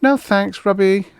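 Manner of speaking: high, light lady's voice brushing him off; bored young woman. Acted. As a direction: wave off the pitch lightly. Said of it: "the young woman yawned".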